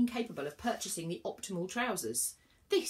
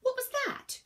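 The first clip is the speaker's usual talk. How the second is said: much higher startled cry; crying out in pain; 6 dB louder, slower than usual. A narrator's reading. Virgo thrown from the table, crying out. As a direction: thrown to the floor, shocked. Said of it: "cried Virgo from the floor".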